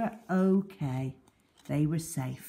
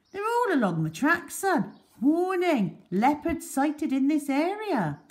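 higher, louder ranger voice explaining; bearded man's voice; 11 semitones higher, 5 dB louder than usual. Acted. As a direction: point out the signs, a bit gruff and firm. Bearded man explains signs.

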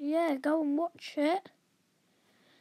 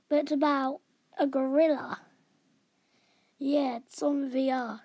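usual speaking voice

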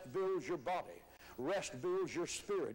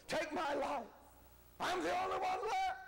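high, strained wail of self-pity; hammed-up prophet begging to die, pitched way up; Elijah's despairing complaint; 11 semitones higher than usual. Preacher acts out Elijah's despairing complaint.

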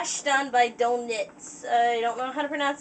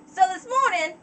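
higher, eager put-on country accent; Apple Bloom accent; 5 semitones higher, 4 dB louder than usual. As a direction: tell it in Apple Bloom's twang. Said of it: "accent I can do for Apple Bloom".

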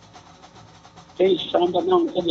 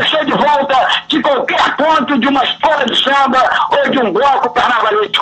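shouting; 7 semitones higher than usual. Real, not acted. Caller shouting a carnival greeting.

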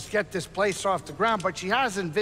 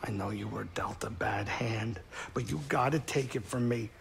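soft counsel; 5 semitones lower, 6 dB quieter than usual. Acted. Greez consoling Cal softly.

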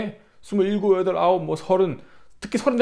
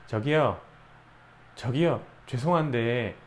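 softer, slow, condescending politeness; snide polite correction; 6 semitones lower, 8 dB quieter, slower than usual. Retold or imitated. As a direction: correct him with icy courtesy. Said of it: "나한테 와서 뭐라 그래".

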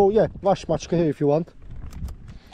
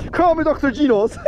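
much higher silly boasting voice; mock boast; 8 semitones higher than usual. Real, not acted. Fisher hypes himself to viewers, goofy high boast. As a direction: brag like a cartoon hero. Celebrating catch, hype voice.

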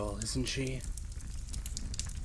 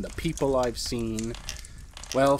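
normal talking voice

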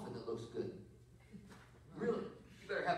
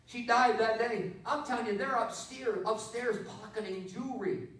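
fiery preaching; 5 semitones higher, 7 dB louder than usual. Real, not acted. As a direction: preach it out to the whole church. Preaches at full force.

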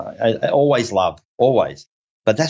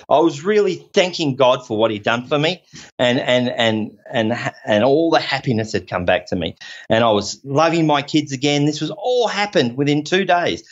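ordinary talk, nothing marked

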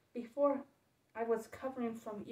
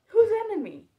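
nervous; 10 semitones higher, 14 dB louder than usual. Acted. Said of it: "I asked n-, nervously".

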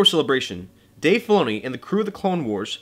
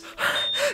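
high breathy gasp; 5 dB quieter than usual. Acted. Fanboy gasping.

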